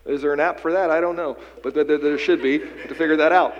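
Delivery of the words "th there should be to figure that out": higher and louder, pressing the call to bold prayer; preaching at full force. Acted. Pastor urging the congregation to pray boldly, preaching at full force. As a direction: lift it into a ringing sermon climax.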